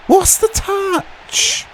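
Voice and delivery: stunned outburst; shocked shout. Real, not acted. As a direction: yelp at the terrible touch. Reacting to a player's touch.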